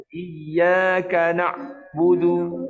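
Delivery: slow chant, ain of "na'budu" badly done; wrong recitation. Shown as a demonstration. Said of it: "Tak betul".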